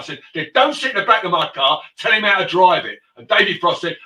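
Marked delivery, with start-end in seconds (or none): 0.3-3.0 loud, heated put-down; loud comeback. Retold or imitated. Chauffeur loudly telling Maxwell off. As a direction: drive the point home loudly.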